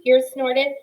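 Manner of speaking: plain reading voice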